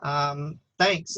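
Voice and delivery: usual speaking voice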